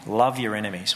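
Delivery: ordinary talk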